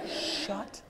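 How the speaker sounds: very soft, halting, word by word; seething restraint. Acted. Early arriver silently fuming at the latecomer, seething. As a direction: hold the irritation down to a near-whisper.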